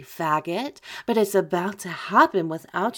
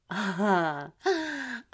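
mocking laugh; 4 dB quieter than usual. Taunting laugh at victim.

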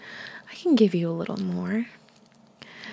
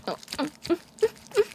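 moans climbing steeply higher; rising moans; 10 semitones higher, faster than usual. Acted. Lover at climax, moans climbing higher. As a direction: let the moans climb as you peak.

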